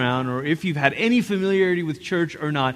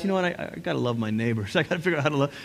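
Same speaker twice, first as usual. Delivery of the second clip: quiet realization; 4 semitones lower, 9 dB quieter, much faster than usual. Retold or imitated. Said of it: "you realize".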